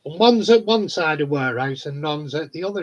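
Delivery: normal talking voice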